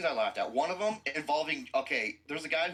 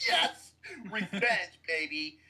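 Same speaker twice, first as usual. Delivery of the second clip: gleeful cheer; 4 semitones higher than usual. Retold or imitated. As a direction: cheer for sweet revenge. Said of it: "I was like".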